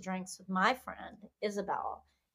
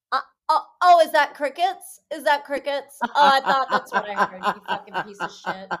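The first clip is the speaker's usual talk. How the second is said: swearing tirade; 7 dB louder than usual. Retold or imitated. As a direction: lay into him, laughing. Mock tirade at silent date.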